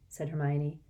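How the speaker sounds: plain reading voice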